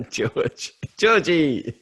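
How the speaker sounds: laughing through the words in a high voice; real laughing tease. Real, not acted. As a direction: giggle out the name teasingly. Teasing the name.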